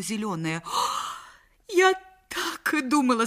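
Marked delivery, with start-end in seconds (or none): 1.7-3.2 high, louder triumphant cry; wronged wife bursts in shouting; jealous shout. Acted. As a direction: catch him out, crying aloud. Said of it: "закричала она".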